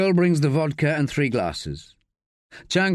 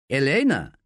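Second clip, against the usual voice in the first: deadpan. A narrator's reading. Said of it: "convincingly deadpan".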